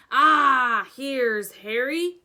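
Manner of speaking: giant's loud glad greeting; pleased giant. A narrator's reading. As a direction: boom out a warm hello to Harry. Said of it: "said the giant".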